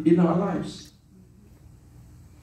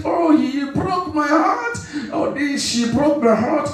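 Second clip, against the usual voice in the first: mock lament; 10 semitones higher than usual. Preacher mocking Christians who cry all night, hammed-up higher wail. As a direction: ham up a heartbroken moan, half shouting.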